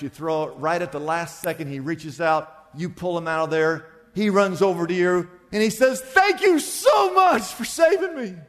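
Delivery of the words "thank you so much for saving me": very high, effusive acted thanks; gushing gratitude. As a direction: pour out overwhelming thanks to your rescuer. Retold rescued man, now overwhelmed with gratitude.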